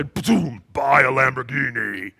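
loud, low, drawn-out mock devil voice; hammed-up demonic announcement; booming devil. Devil at your door tempting you to spend, loud and booming. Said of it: "It wasn't like that".

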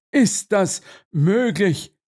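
slow, high disbelief; astonished question. A narrator's reading. Colonel learns it is his horse, astonished.

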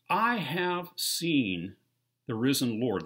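slow, loud, high proclamation; preacher voicing Paul's testimony, slow and loud; proclaiming. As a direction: stretch out every word like a testimony.

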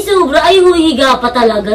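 usual speaking voice

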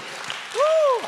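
short, very high-pitched "woo"; praise whoop. Real, not acted.

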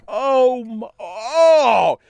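high anguished cry; high strangled cry; cry of frustration. Retold or imitated. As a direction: break into a choked cry. Overwhelmed outburst.

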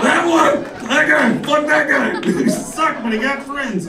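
jab at the guy in the crowd; mock angry pointing. Comic imitates heckler pointing out another comic, high mock yell. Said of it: "He goes".

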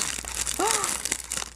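high breathy gasp of surprise; a quiet, delighted intake of breath; hushed surprised gasp; soft gasp. Real, not acted. Discovering a homemade cookie.

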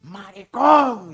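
slow high yell bursting on the last word; big shout. Retold or imitated. Producer yelling through thick glass to the announcer, big retold shout. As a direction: build to a loud, stretched cry. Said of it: "pero así un gran grito".

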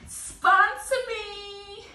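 high, loud, very drawn-out call; playful call-out. Pretend. Vlogger playfully begging a brand for sponsorship, drawn-out call. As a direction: stretch it out high like hailing someone far off.

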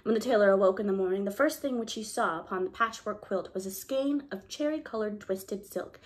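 plain reading voice

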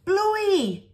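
put-on high, loud child's call; yell. A narrator's reading. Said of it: "yells Bingo".

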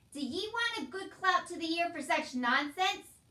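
high, loud angry demand; threatening uncle. A narrator's reading. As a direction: threaten the child at full voice.

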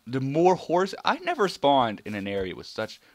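high, loud excited cry; excited at the herd; excited exclaim. Real, not acted. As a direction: shout it, thrilled. Excited at many horses.

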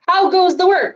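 high, loud farmer's call. A narrator's reading. The lazy farmer calls out to the duck, high and loud. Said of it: "called the farmer".

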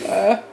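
real sneeze, loud and high; sneezing. Real, not acted. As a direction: let a real sneeze burst out. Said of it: "I'm gonna sneeze".

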